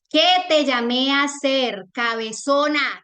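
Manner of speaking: retold stern rebuke. Retelling what a sermon told her, loud stern rebuke. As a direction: call someone out slowly and forcefully.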